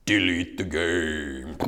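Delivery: very low, slow mock voice of God; hammed-up divine command, dropped low; voice of thunder. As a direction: draw it out deep and slow like thunder. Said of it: "this voice of thunder in my brain".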